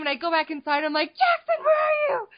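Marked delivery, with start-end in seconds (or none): 1.1-2.3 very high mock scream; hammed-up frantic call through the house; retold frantic screaming. Mother back inside hunting for her son, high panicked mock call. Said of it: "I'm screaming his name".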